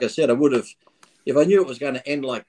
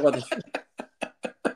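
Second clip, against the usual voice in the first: 1 drawn-out shocked; 13 semitones higher, 5 dB quieter, much slower than usual. Retold or imitated. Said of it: "And I'm like".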